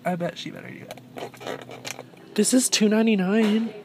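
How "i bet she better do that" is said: whispered aside. Real, not acted. Muttered aside.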